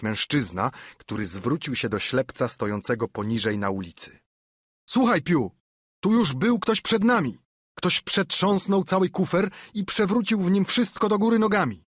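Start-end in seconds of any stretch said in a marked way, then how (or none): 4.9-11.8 high loud shout from the window; calling down. A narrator's reading. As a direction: shout the news down to the street. Pirate calls from window. (pirat woła z okna do ślepca)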